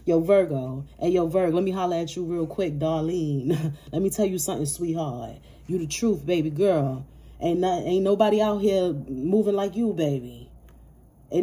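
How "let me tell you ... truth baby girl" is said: usual speaking voice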